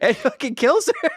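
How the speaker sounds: can't hold back the giggle; high laughing blurt. Real, not acted. Laughing while recounting the kill.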